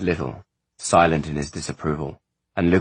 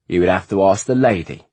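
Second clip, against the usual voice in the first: "lady" hit loud and high; stress on lady; 4 semitones higher, 6 dB louder than usual. Acted. Freeze hinting at Ishrak to Isolde, stressing "lady". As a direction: lean hard on "lady" with a pointed edge. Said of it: "with much emphasis on the noun".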